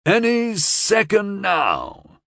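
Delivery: slow, drawn-out announcement; announcing. A narrator's reading. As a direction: announce it with relish, slowly. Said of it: "an unseen man announced".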